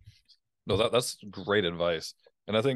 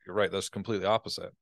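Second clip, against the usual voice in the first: usual speaking voice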